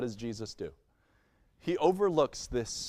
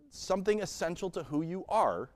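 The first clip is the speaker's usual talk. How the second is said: ordinary talk, nothing marked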